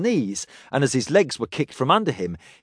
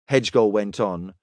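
ordinary delivery, nothing marked

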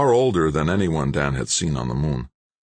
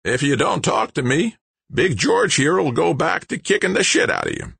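high, raspy threat; sandpaper-voiced old man; 13 semitones higher than usual. A narrator's reading. Old man threatening the stranger with Big George, raspy. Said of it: "said in a voice like sandpaper".

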